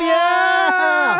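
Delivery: loud, high whoop; big shouted yeah; excited cheer. Real, not acted. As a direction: cheer like the crowd went wild. Cheering the announcement.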